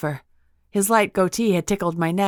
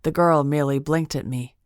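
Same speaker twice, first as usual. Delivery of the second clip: ordinary delivery, nothing marked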